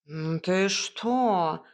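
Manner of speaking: slow, drawn-out indignant cat; indignant hiss. A narrator's reading. Cat answers a shocking accusation, with a drawn-out indignant hiss. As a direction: stretch it out in offended disbelief. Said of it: "Кот возмущённо зашипел".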